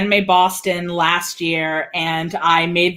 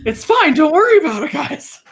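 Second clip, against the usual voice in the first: high, put-on breezy reassurance; Jester character voice; 7 semitones higher, 3 dB louder than usual. Teacher reassuring puzzled students, high Jester voice. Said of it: "I'll say something in that Jester voice".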